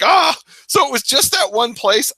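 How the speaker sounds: re-enacted high-pitched exasperated cry; tortured outcry. Retelling his torturing search for the name, tortured outcry. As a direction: cry out as if tormented, acting it out. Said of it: "it's, like, torturing me".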